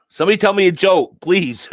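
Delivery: high, loud half-laughing plea; pleading for a laugh. Real, not acted. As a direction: beg them to lighten the mood. Begging chat to cheer him.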